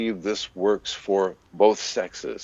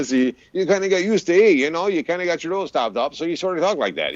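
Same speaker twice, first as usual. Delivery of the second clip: mock Milwaukee accent, stuffed nose and higher, fast; nasal Milwaukee accent; 10 semitones higher, faster than usual. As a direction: imitate a Milwaukee local with a blocked nose. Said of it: "everyone sort of had a nasally accent".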